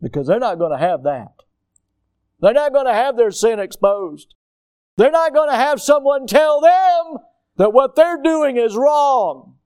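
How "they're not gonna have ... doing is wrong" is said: rising higher and louder to a shout on them; building preacher's fervor. Acted. Preacher to the congregation, climbing to a loud, high cry. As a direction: drive it home, climbing in force.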